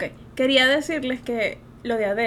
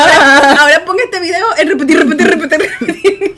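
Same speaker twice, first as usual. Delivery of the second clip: loud, high, laughing; giddy, pitched-up repetition; laughing excitement; 3 semitones higher, 9 dB louder than usual. Real, not acted. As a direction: blurt it out quickly through laughter. Laughing joke about looping the video.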